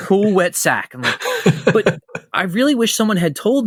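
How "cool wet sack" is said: higher-pitched cartoon yokel repeating the lesson; mock cartoon student echo; Cletus. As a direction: imitate an eager note-taker, pitched up. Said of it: "Cletus, like, writes it down".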